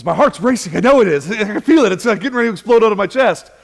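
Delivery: wife insisting her heart will explode, imitated with a laugh; mock panic.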